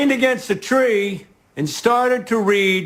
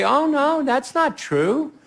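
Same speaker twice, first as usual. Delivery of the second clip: higher-pitched, lightly voiced wife consoling her husband; imagined reassuring wife; 6 semitones higher than usual. Comedian imagining his wife's comfort, lightly voiced reassurance. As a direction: lightly play a wife gently contradicting him.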